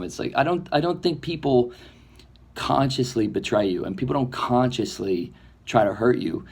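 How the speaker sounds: normal talking voice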